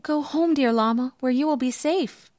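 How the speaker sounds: high-pitched, urgent alarm, lightly acted; a touch of storybook fright; frightened. A narrator's reading. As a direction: send a pet away in a fearful high voice. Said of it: "Trembling with fright".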